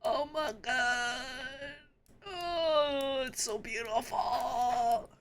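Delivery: slow, soft, hushed awe; softly stunned by its beauty; awed. Real, not acted. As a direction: breathe it out in wonder. Awed by the art.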